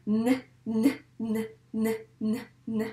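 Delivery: N repeated as separate "nn" sounds; letter sound N. Shown as a demonstration. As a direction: model the sound of N, pausing between each. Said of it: "On va dire le son de cette lettre". (N répété comme son « nnn », bien détaché)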